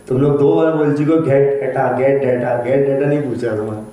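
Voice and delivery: normal talking voice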